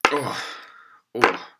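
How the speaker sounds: ordinary delivery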